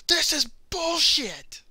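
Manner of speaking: high, slow whiny complaint imitating Seth; mock angry friend. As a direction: drag it out like a sulking gamer. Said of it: "And he's like".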